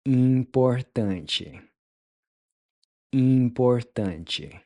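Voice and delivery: "Importante" slow, with a nasal start and "chi" at the end; slow full model. Shown as a demonstration. Teacher putting the whole word together slowly for students. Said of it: "putting it all together".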